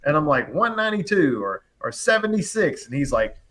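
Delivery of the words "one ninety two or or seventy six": high-pitched mock calling out, no real volume; retold yelling. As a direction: mimic calling numbers across a house. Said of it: "we're yelling across the house".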